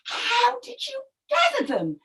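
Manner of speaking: puzzled storybook wife; questioning crocodile wife. Acted. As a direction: draw out the questions to a husband.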